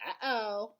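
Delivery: high squawky parrot uh-oh; lightly voiced parrot chirp. Parrot Thelma's catchphrase.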